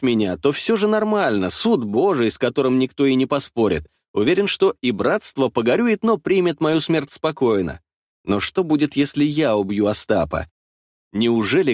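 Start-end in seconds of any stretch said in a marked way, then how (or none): none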